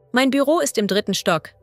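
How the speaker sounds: normal talking voice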